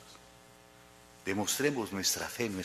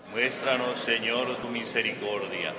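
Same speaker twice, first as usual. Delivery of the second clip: congregation response; 3 semitones higher, 5 dB louder than usual. Real, not acted. Assembly answers the prayer together.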